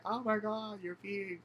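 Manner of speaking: high-pitched scandalized exclamation; shocked amusement. Acted. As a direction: gasp it out high, astonished. Shocked at friend peeing openly.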